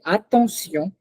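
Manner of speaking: attention with a clearly separated "a"; distinct pronunciation. Shown as a demonstration. Teacher repeating "attention" for students, very distinctly. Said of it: "very distinctly".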